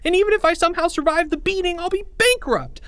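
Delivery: put-on very high, fast boy voice; high hurried kid voice; panicky kid voice. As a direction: rush it in panic. Boy frets about money.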